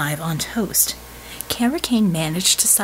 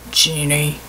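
low warning growl; 5 semitones lower than usual. Acted. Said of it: "growled Bulwark".

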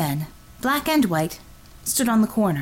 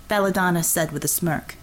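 normal talking voice

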